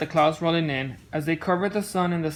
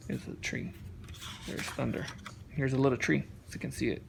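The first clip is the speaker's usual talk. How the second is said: softer, whispered side comment; hushed aside; 5 semitones lower, 11 dB quieter than usual. Real, not acted. Dad quietly pointing at the picture for his son, whisper.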